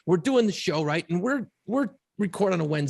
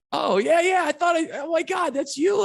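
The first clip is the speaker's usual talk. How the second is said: much higher and louder, gushing recognition; excited fan; 5 dB louder than usual. Retold or imitated. Woman realises she's met the podcast host, excited and loud. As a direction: squeal it like a fan spotting a star. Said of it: "She goes".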